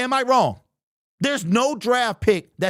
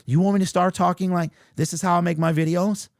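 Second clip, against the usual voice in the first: ordinary talk, nothing marked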